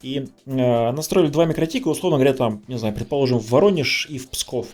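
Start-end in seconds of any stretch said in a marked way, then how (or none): none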